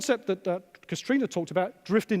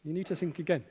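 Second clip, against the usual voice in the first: softer, lower repeat of "You need to think again"; quiet echo; 4 semitones lower, 6 dB quieter than usual. Real, not acted. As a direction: let it sink in, dropping softer and lower.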